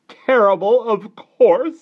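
high, loud complaint; disgusted aunt. A narrator's reading. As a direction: pronounce it awful at full voice. Aunt grumbles about the tea.

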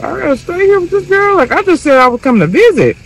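put-on high upset child voice; mock little kid wailing; crying little boy. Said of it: "just like".

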